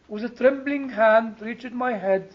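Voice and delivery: ordinary delivery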